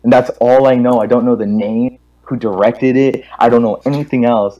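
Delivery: ordinary talk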